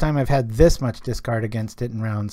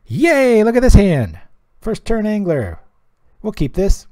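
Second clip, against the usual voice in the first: higher and louder, delighted about the hand; excited cheer; 9 semitones higher, 6 dB louder than usual. Real, not acted. Streamer cheering his first-turn Angler hand, real delight. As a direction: gush over a dream opening draw. Thrilled at a great opening hand.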